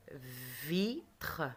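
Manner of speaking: "Vitre" drawn out, vi held, then a gap before tre; "Vitre" broken slowly in two for pupils; slow syllable split. Shown as a demonstration. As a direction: stretch and separate "Vitre" into two beats. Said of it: "je tape les syllabes".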